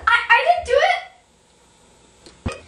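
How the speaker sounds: slow, loud, high protest; high panicked denial. Acted. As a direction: plead innocence desperately. Desperate denial.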